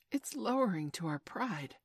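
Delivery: ordinary delivery, nothing marked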